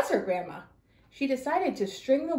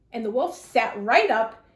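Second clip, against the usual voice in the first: usual speaking voice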